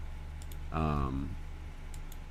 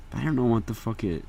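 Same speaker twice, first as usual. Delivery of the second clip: ordinary talk, nothing marked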